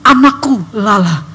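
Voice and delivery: slow, measured voice of God; loving, authoritative. Retold or imitated. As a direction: speak deliberately, as a father guiding his child. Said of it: "penuh kasih, berotoritas".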